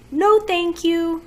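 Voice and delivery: high, slow, drawn-out refusal; drawn-out, mock-polite dismissal; sarcastic no thank you. Real, not acted. Sarcastic rejection of product.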